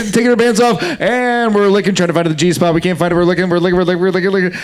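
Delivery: rattled-off mock play-by-play; sports commentator. Commentator parody for an improv prompt, urgent play-by-play. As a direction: keep the commentary rolling, repeats tumbling out. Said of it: "as a sports commentator".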